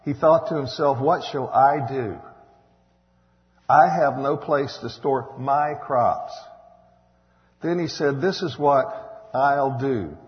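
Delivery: forceful preacher reading the Bible aloud; scripture reading. Acted.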